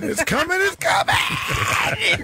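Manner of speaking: high-pitched shout, last one drawn out; building frenzy. Pretend. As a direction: wail it in mock alarm. Hammed-up lewd joke.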